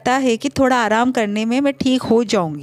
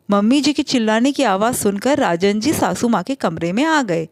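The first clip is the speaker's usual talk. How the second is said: plain reading voice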